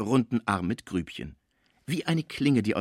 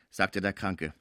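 usual speaking voice

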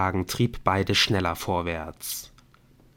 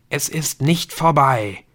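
slow, loud, raised-pitch voice of doom; sepulchral voice; 6 semitones higher, 6 dB louder, slower than usual. A narrator's reading. Emily making a threatening phone call, sepulchral. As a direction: intone it slowly, like a verdict from the grave. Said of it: "sagte Emily mit Grabesstimme".

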